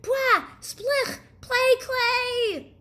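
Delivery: high, loud disgusted shriek; disgusted yell. Acted. Boy biting the teacher's clay cookie, yelling in high disgust. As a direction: spit out the awful taste, yelling. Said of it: "And he yelled".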